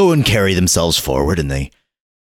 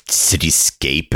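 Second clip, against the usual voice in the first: ordinary delivery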